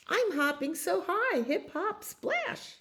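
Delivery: way up high and bouncy; a tiny rabbit's high, springy voice. Acted. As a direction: squeak it like a hopping bunny. Rabbit joins the song.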